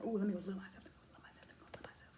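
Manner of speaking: hushed whisper, fading to almost nothing; playful whispered muttering, trailing away; whispered self-talk. Acted. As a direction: whisper it quickly to yourself.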